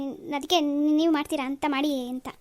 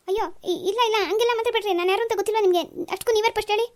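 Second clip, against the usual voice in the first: higher, fast, panicky denial; flustered protest; 6 semitones higher, faster than usual. Acted. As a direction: hurry to deny, rattled. Suitor flustered by accusation.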